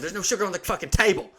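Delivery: exasperated. Retold or imitated. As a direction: snap back in disbelief. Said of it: "I was like".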